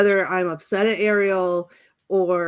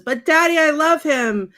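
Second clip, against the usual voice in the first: put-on high, pleading teen whine; mock a dramatic daughter begging her dad; whiny Ariel; 8 semitones higher, 5 dB louder than usual. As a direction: Ariel whining to her father, hammed-up and higher. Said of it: "every single time that Ariel says".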